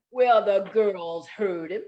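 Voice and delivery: usual speaking voice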